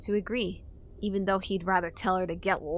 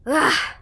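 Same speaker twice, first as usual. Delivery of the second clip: frustrated ugh; 7 semitones higher, 9 dB louder than usual. A narrator's reading. Frustrated groan.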